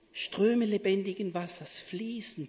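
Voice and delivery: ordinary talk, nothing marked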